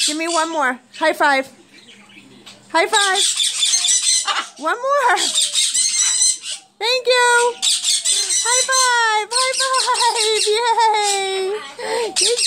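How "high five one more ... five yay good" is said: excited cheer. Real, not acted. Cheering bird's tricks.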